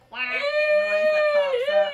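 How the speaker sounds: high, loud excited voice. Real, not acted. Excited talk to child.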